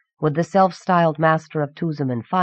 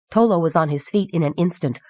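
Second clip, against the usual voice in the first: usual speaking voice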